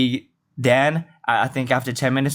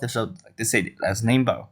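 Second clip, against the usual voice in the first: ordinary talk, nothing marked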